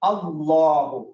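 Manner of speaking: اللہ drawn out very slowly; teacher's slow model word; slow example word. Shown as a demonstration. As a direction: stretch out اللہ to show the zabar. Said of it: "زبر کی مثال جیسے".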